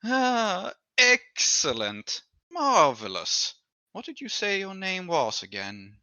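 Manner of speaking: high, slow, drawn-out noble patron; patron's voice. Acted. GM voicing the patron, pleased by the player's answer, high and slow. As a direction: savour each word like a pleased aristocrat.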